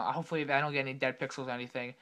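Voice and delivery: ordinary delivery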